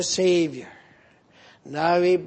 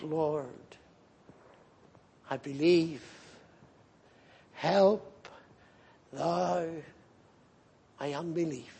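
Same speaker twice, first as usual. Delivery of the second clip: hushed plea; 2 semitones lower, 9 dB quieter than usual. Acted. Father's plea retold softly.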